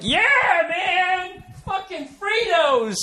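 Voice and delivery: high, slow, strung-out craving; craving body voice. Pretend. Body craving junk food, high slow whiny voice. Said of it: "your body isn't, like, freaking out like".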